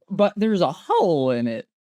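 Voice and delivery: lightly mimicked Southern accent. Fan quoting the movie detective's donut speech, imitated Southern accent. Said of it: "it's because of the accent".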